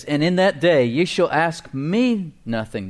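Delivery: slow verse reading, leaning hard on "me"; stress on "me". Shown as a demonstration. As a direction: make "me" the long, weighty word before "nothing". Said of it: "The emphasis there is on the word "me".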